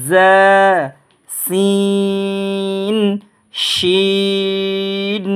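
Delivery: slow, measured recitation of each letter; alphabet recitation. Shown as a demonstration. Teacher reciting the whole Arabic alphabet for students. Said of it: "পুরোটা একবারে আপনাদেরকে আমি শুনিয়ে দিই".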